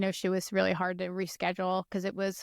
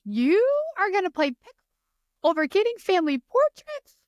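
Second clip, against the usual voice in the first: incredulous scolding; 11 semitones higher, 4 dB louder than usual. Retold or imitated. Family scolding her, retold.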